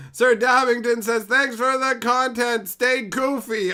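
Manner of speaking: high, loud message read; hollered shout-out. Real, not acted. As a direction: holler the viewer's message, stretching it out.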